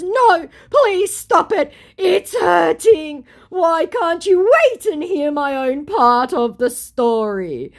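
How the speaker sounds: very high, loud, slow wail; hammed-up wounded begging; mock hurt pleading. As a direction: drag out every plea like a tragic victim. Scammer's hurt pleading, acted out.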